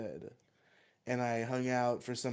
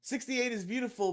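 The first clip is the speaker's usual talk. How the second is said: much higher, insistent exclamation; emphatic high; 10 semitones higher than usual. Real, not acted. Repeats the claim emphatically.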